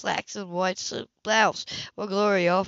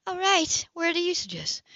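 high, resigned concession; Sybil's voice; 10 semitones higher than usual. A narrator's reading. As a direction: give in, voice lifted. Sybil yields.

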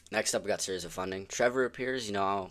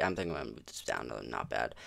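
normal talking voice

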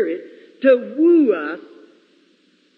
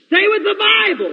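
exhorting cry; 8 semitones higher, 5 dB louder than usual. Real, not acted. Preacher exhorting congregation.

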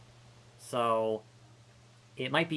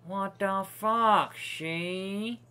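drawn-out disbelief; 4 semitones higher, much slower than usual. Real, not acted. Reacting to game in disbelief.

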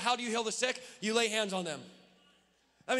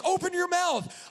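retold scream; 7 semitones higher, slower than usual. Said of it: "I was over her screaming".

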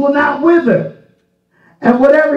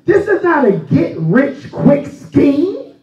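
ordinary delivery

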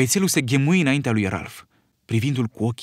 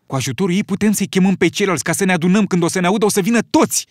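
excited; 7 semitones higher, 4 dB louder, faster than usual. Acted. Piggy excited by shell idea.

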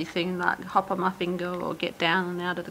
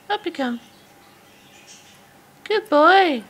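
high, sing-song coaxing to a bird; pet talk voice; 9 semitones higher, 8 dB louder than usual. Real, not acted. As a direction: coax a shy budgie out. Coaxing bird onto finger.

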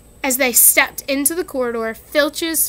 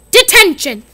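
louder, higher stern shout; 7 semitones higher, 11 dB louder than usual. A narrator's reading. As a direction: bark at the caught boy. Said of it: "she shouted".

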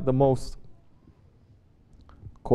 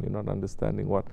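ordinary talk, nothing marked

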